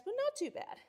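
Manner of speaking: sudden high, breezy not too bad; high and breezy, shrugging it off; high light brush-off. Real, not acted. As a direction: toss it off lightly. Shrugging off a pipe burst.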